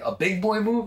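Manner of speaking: high, disbelieving question; incredulous. Retold or imitated. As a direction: repeat it like you can't believe it. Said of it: "I'm like".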